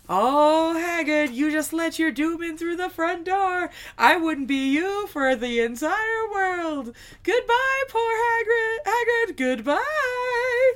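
high, loud, drawn-out theatrical farewell; gleeful taunting. Acted. Departing wizard taunting the king, gleefully theatrical. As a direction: sing out the doom with mocking glee. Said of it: "he starts laughing and is like".

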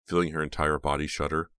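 ordinary delivery

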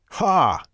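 loud high scornful "Ha!"; mocking shout of laughter. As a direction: bark a triumphant laugh. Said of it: "the man shouted back".

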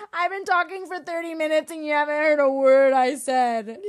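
high, loud, exasperated protest; indignant complaint. Acted. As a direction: complain in hammed-up outrage at being ignored. Mock quarrel over not being heard.

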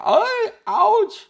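sudden very high, loud yelp of pain; Larry's yelp. Acted. Bitten grave robber yelping at the hamster, shrill and loud. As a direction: shriek it like you've just been bitten. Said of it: "y Larry grita".

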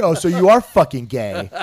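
loud, barking drill sergeant. Retold or imitated. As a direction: bellow at the recruit. Drill instructor yelling.

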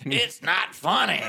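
slow, high mock outrage; indignant, drawn-out mimicry; big mad retelling. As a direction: imitate an angry man scolding someone who's laughing. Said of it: "He was big mad".

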